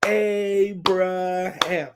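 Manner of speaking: Abraham split syllable by syllable, very slow; broken down slowly. Shown as a demonstration. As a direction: sound out Abraham for kids. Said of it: "let's break it down like this".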